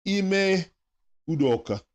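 "Ime" pitched high, "Udoka" falling; drawn-out name. Shown as a demonstration. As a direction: call out the name like a chant. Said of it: "say it with me".

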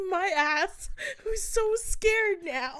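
high-pitched words through real laughter; helpless laughter. Real, not acted. Storyteller admits being scared on the train, helpless laughter. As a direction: squeal it out, nearly in tears laughing.

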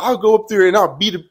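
loud, high imitation of a threat; man's retold threat. Stranger threatening the teller, imitated angry yell. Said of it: "a man's voice yells back at me".

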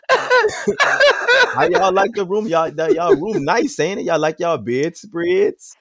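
high, drawn-out New Orleans mom; teasing imitation of a New Orleans mother; New Orleans accent. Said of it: "In her New Orleans accent".

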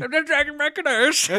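high-pitched, garbled cartoon complaint; hunched adventurer voice. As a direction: whine it as the absurd canoe-dragging adventurer. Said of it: "He's like".

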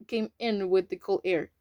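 usual speaking voice

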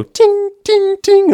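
high, loud ringing "ting" noises; imitated hammering ting. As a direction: imitate a tinny game hammer clink. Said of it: "we can hear hammering".

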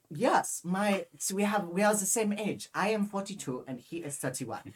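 put-on silly German accent, starting high; strong German accent. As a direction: insist in a stupid German accent. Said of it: "I had a really strong, stupid accent".

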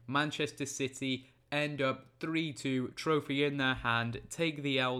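ordinary delivery

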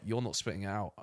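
low, soft, stern mum; stern quiet mum. Retold or imitated. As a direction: lay down the rule quietly. Mum's stern reply.

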